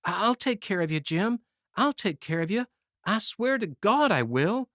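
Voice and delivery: high, loud frantic pleading; hysterical cry. Acted. Youth crying out hysterically to his dying friend. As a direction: promise it in a panic, voice climbing. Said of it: "cried out to him hysterically".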